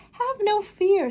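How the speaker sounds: put-on the Cat in the Hat calming the children, big and upbeat. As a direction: reassure the kids with a grand flourish. Cat boasts.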